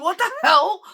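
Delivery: high, loud startled cry; jolted by what you see; shocked outburst. Retold or imitated. Driver spotting the bolt, high loud startled cry.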